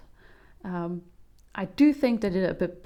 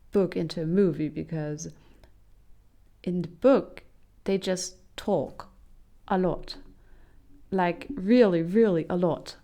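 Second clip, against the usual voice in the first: usual speaking voice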